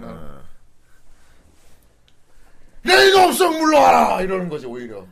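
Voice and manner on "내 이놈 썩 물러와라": slow, booming hearty shout. Retold or imitated. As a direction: roar it out boldly. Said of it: "호탕하게".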